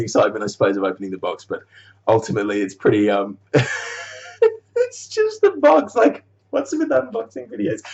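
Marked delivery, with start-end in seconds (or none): 4.8-7.8 mock squeaky voice. Mocking unboxing videos.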